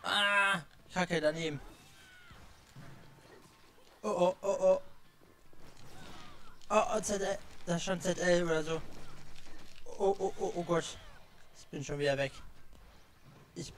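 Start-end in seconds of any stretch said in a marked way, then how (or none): 0.0-11.0 panicking gamer. Real, not acted. Frantic fight, losing lives.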